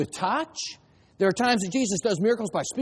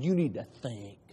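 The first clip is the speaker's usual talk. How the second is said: quiet voiced Jesus; 10 semitones lower, 8 dB quieter than usual. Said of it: "and he said".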